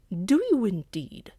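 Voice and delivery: slow, loud sneer. Acted. Dog sneers at the cat's boast, slow and loud. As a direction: drag it out with scorn. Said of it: "sneered Blackfoot".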